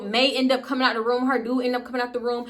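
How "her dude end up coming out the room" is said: ordinary talk, nothing marked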